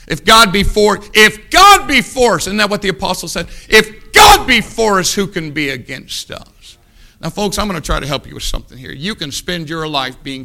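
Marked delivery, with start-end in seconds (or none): 0.1-2.4 preacher's rising cry; preaching. Real, not acted. 3.7-6.8 preaching. Real, not acted.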